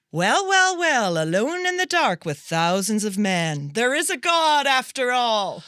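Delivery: milk it like a cabaret star; bawdy diva quote. Acted. Quoting a [name] bawdy line.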